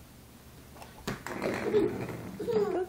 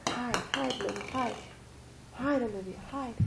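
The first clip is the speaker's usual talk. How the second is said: hushed game voice; 8 semitones lower, 8 dB quieter than usual. Pretend. Hide and seek game.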